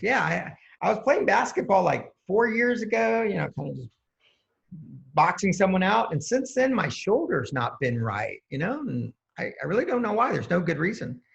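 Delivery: a hint of a baffled regular guy; Joe the plumber. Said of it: "He goes".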